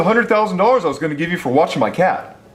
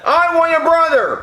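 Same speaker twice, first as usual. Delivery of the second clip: put-on whiny kid voice; 7 semitones higher than usual. Kid character whining.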